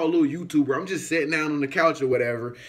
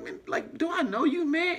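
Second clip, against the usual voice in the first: startled high question; 12 semitones higher, 4 dB quieter than usual. Retold or imitated. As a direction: voice jumps up high, mock bewildered. Stranger approaches, retold reaction.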